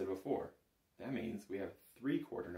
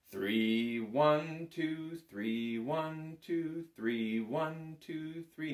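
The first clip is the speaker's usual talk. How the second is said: each one lifted higher and louder; counting in three; 7 semitones higher, 4 dB louder, much slower than usual. Shown as a demonstration. Teacher counting the pickup bar to the student. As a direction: count the beats aloud, stressing one. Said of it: "you've already counted one".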